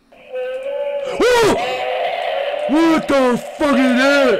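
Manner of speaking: sudden high shout of fright, drawn out on the question; real jump scare, yell it out; startled yell. Real, not acted. Sudden scare in horror game.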